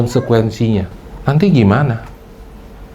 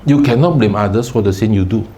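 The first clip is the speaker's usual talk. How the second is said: ordinary talk, nothing marked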